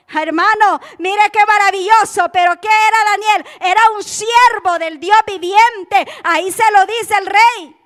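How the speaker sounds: high, loud exclaiming preacher; rousing pulpit fervor; full-force preaching. Real, not acted. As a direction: proclaim it to the whole congregation.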